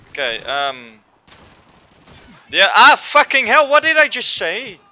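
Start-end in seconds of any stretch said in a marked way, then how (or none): 2.5-4.8 high, loud outburst of anger; real rage, loud and high; furious shout. Real, not acted. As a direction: explode at the enemy. Attacked again in game.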